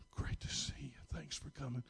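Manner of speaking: retold in a near-whisper, barely voiced; hushed greeting. Worried husband thanking the pastor at the hospital bedside, hushed. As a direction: drop right down to a soft whispered thanks.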